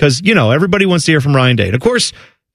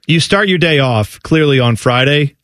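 ordinary talk

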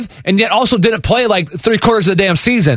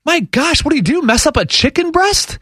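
incredulous; 9 semitones higher than usual. Retold or imitated. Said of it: "And I'm like".

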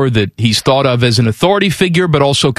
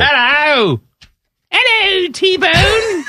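squeaky-high mock English greeting; hammed-up Cockney chimney sweep, pitched way up; chimney sweep accent; much slower than usual. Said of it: "chimney sweep".

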